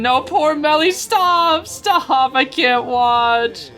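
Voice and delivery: high, drawn-out wail of dismay; distressed pleading. Real, not acted. Caster watching poor Melly lose, high distressed pleading. Distressed at favourite character losing.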